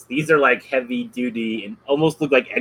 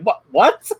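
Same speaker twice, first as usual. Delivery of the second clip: mock confusion; 6 semitones higher, 5 dB louder than usual. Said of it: "he's just like".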